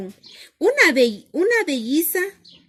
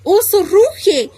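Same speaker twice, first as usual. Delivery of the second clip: higher, louder, climbing storytelling; building excitement; 7 semitones higher, 6 dB louder than usual. A narrator's reading. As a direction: build up as the bear roars awake. Bear wakes, growls, roars.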